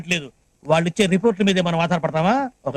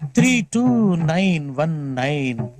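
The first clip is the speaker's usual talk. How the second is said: drawn out, each digit spaced and deliberate; slow number recital; 2 semitones lower, much slower than usual. Acted. A weather anchor slowly reading out his cell number. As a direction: spell the number out very slowly for a caller.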